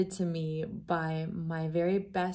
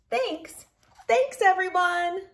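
higher, sweet polite thank-yous; 11 semitones higher, 5 dB louder than usual. Acted. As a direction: chirp the thanks up brightly and kindly. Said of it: "each one says politely".

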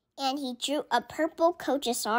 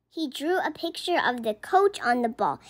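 plain reading voice

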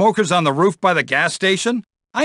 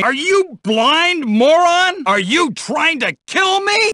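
furious yell; 10 semitones higher than usual. Acted. At a kid who nearly hit him, furious yell. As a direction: scream at the reckless kid.

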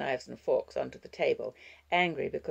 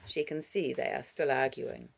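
usual speaking voice

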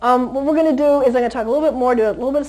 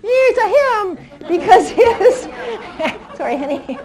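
laughing hard, higher; 5 semitones higher, 6 dB louder than usual. Real, not acted. Trainer laughing after checking the puppy's sex. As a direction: laugh through the words, embarrassed.

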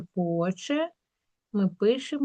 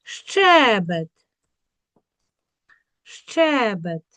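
"Щебет" with the first Е stretched; stress on Е; much slower than usual. Shown as a demonstration. Teacher demonstrating the check word, stressed Е. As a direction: lean on the first syllable of "Щебет". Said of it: "був під наголосом".

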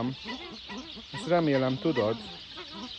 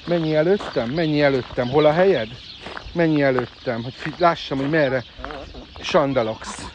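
firm command; 3 semitones higher, 6 dB louder than usual. Real, not acted. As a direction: drive the bird ahead firmly. Ordering a bird ahead of her. (határozott, hangos terelés)

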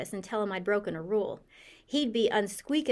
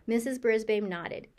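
plain reading voice

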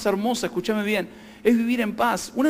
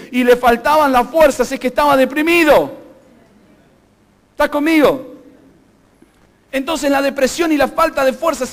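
high-pitched, emphatic pulpit delivery; forceful preaching; 4 semitones higher, 4 dB louder than usual. Acted. Pastor tells the church joy and strength are linked, forceful preaching. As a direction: proclaim it to the whole room.

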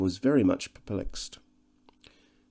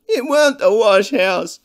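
high, loud, wailing sob; sobbing half-wit; 14 semitones higher, 9 dB louder than usual. Acted. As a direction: plead through tears not to go back. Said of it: "sobbed the half-wit".

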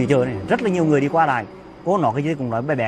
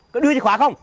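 much higher, loud furious demand; angry yell; 10 semitones higher, 4 dB louder than usual. Acted. Husband shouting at wife for the car keys.

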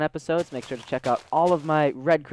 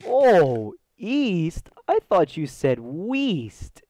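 high, slow, dopey Patrick voice; Patrick impression; 5 semitones higher, 4 dB louder, much slower than usual. Fan quotes Patrick from the SpongeBob episode, dopey and slow. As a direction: draw out the words like a dim cartoon starfish. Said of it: "Patrick's like".

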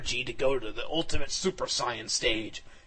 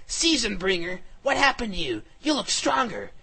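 high, raised call of astonishment; yelled; 7 semitones higher, 4 dB louder than usual. A narrator's reading. Hero yelling to his dragon who looks stronger, yelled. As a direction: call out to the dragon, pitched well up. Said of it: "I yelled".

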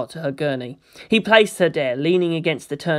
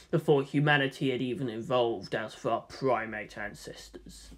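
low gruff-free Space Marine musing; 4 semitones lower, 6 dB quieter than usual. Acted. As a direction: speak lower with grudging respect. The Space Marine was finally brave enough.

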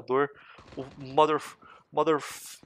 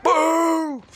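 high, loud, long "pum"; cartoonish high boom; imitated gunshot boom; 13 semitones higher, 8 dB louder than usual. Real, not acted. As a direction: sing out the bang. Imitates shot while firing.

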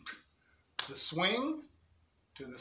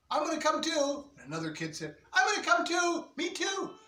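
put-on eager kids; 10 semitones higher than usual. Kids begging to come along, high eager voices. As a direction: pipe up to tag along.

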